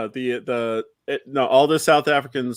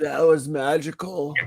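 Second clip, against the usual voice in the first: slow, lightly dazed retold wonder; blissed-out mock awe; 5 dB quieter, slower than usual. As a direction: imitate someone coming round, faintly spellbound. Retold blissed-out comedown after convulsions.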